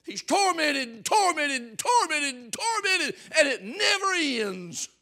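loud, high fire-and-brimstone preaching; preaching at full force. Acted. Preacher warning the church about hell, full-force shouting. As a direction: thunder it at the congregation.

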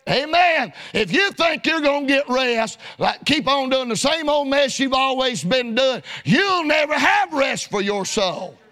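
ordinary talk, nothing marked

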